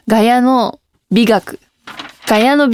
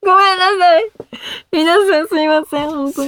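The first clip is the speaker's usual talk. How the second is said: a hammed-up, half-crying apology, higher; mock whimper; 7 semitones higher than usual. To her listeners after fleeing the chat, a mock whimpering sorry. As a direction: whine sorry like a scolded kid, half in jest.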